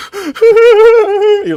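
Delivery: a loud high laugh breaking through the word; bursting laugh. Real, not acted.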